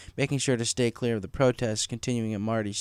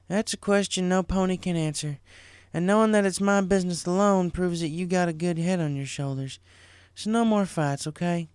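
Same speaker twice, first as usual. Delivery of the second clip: put-on a little higher, gently firm older sister; a hint of a caring big sister; lightly voiced big sister; 7 semitones higher than usual. A narrator's reading. Big sister settling little sister's fight, lightly voiced.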